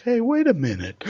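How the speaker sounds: high-pitched mock double take; mock suspicion. Women in Boston catching his accent slip, high mock suspicion. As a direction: imitate someone suddenly catching on, pitched high.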